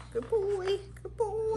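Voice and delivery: high pet-talk praise; sing-song praise for a dog. Real, not acted.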